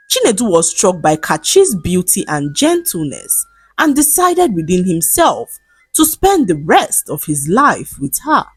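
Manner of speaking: ordinary delivery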